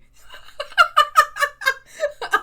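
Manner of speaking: loud squealing laugh; high-pitched burst of laughter. Real, not acted. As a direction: break into a high helpless laugh. Cracking up over accent.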